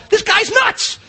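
ordinary talk, nothing marked

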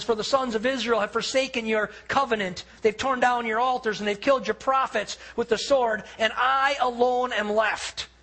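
impassioned retold cry; crying out to God. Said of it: "He cries it out to God".

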